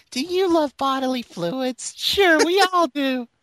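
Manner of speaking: high, slow charity-ad plea, voice pushed way up; Sally Struthers impression. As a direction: do a hammed-up Sally Struthers pleading for donations. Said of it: "what voice would suit this would actually be, like, uh, Sally Struthers".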